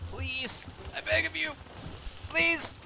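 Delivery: high-pitched begging; pleading. Pretend. Begging for the fish.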